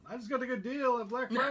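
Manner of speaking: excited mock ad; laughing. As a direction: laugh through a cheery pitch. Joking ad-like line.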